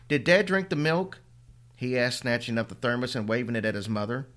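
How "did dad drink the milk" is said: high, louder rising question; urgent question. Acted. Boy asking his mother about the thermos, urgent. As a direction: demand the answer urgently. Son asks about the milk.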